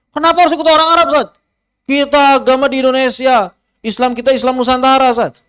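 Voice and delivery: high, loud whiny protest; mock protesting student. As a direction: play the stubborn student arguing back. Student challenging the ustaz. (murid ngeyel memprotes ustaz dengan suara tinggi dan keras)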